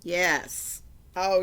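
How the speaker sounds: usual speaking voice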